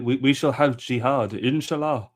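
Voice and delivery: hammed-up mock German accent, rising in pitch; slow mock-German accent, lifting at the end; put-on comic accent. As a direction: play along in a jokey German accent. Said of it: "that was a great accent".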